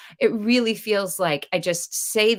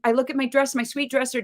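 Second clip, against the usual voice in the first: normal talking voice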